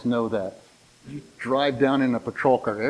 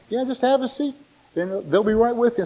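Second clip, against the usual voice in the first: higher-pitched receptionist voice, imitated; 7 semitones higher than usual. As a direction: lightly mimic a front-desk welcome, pitched up. Acted receptionist greeting him at dentist.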